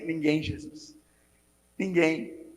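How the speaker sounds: soft reply. Retold or imitated. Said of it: "você vai falar pra ele assim".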